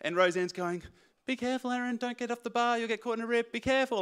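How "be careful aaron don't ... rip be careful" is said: pitched higher, a mild mock yell of warning; mock yelling mother-in-law. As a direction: imitate a worried mother calling her son back. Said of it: "she's yelling out".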